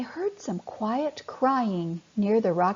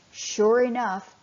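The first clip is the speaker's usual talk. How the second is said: usual speaking voice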